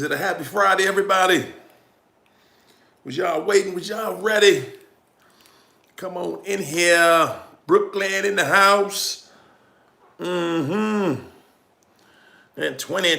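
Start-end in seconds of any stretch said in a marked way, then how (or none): none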